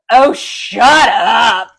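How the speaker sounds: loud, slow, drawn-out shut up; mock shout. Leaning out of her pod at the mouthy mom, loud slow shout. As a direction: bellow it out of the pod, stretched. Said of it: "and I go".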